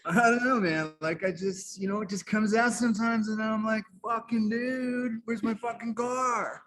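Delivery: high-pitched California dude voice; high, stretched-out American dude parody; Wayne's World slacker. Acted. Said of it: "It comes from a lot of Wayne's World".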